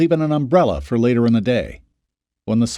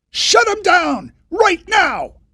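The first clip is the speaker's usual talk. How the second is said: much higher, louder, slow full shout; 5 dB louder, much slower than usual. Acted. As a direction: scream it so the foreman finally gets it. Foreman not understanding.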